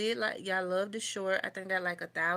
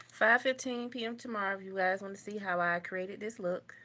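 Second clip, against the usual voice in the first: normal talking voice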